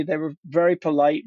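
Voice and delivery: ordinary talk, nothing marked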